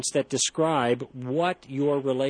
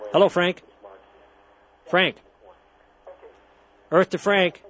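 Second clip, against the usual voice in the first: higher, louder call down the line; 5 semitones higher, 4 dB louder than usual. Real, not acted. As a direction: hail someone who isn't answering. Host calling unresponsive caller on line.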